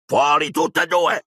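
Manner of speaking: uncle's angry croak; croaked order. A narrator's reading. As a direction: bark them out of the room. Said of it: "gracchiò zio Vernon".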